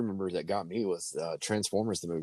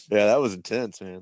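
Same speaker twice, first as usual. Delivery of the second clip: normal talking voice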